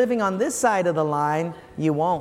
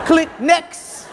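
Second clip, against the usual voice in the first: higher, louder, brisk click next; abrupt cut-off; 6 semitones higher, 5 dB louder than usual. Retold or imitated. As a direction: slam the phone down with it. Host cuts caller off.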